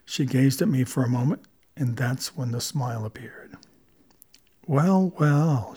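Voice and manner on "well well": slow-smiling feline purr. A narrator's reading. Felicia finding the narrator at her door, purring. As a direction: purr it at an unexpected visitor. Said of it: "she purred".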